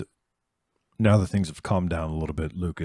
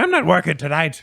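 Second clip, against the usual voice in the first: put-on Herb accent; 9 semitones higher, 8 dB louder than usual. Repeat of Herb line.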